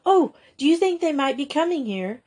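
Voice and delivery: a touch of schoolgirl pleading; imploring. A narrator's reading. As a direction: implore her hopefully. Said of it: "implored Grisel".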